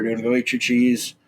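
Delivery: ordinary delivery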